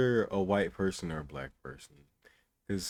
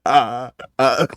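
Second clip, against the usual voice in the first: sharp, loud refusal; 5 semitones higher, 12 dB louder than usual. Real, not acted. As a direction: blurt it out loudly. Loud reaction to clip.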